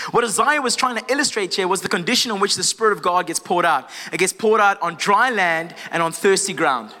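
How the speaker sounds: usual speaking voice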